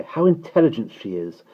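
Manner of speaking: delighted grown-up fussing; admiring cry. A narrator's reading. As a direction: coo over a clever baby. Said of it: "they would cry".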